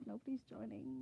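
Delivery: normal talking voice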